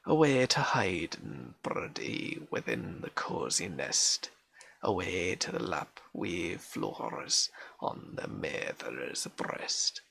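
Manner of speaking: reading a Scots lullaby poem in a broad Scots accent. Shown as a demonstration. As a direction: roll the Scots words gently, like a granny's old song. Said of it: "forces you to read in an accent".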